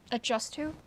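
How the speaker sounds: a burp coming up while talking. Real, not acted. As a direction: speak through a small burp. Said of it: "I, I'm burping".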